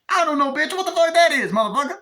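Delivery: mock gangster yell. Acted gangster dialogue from movie.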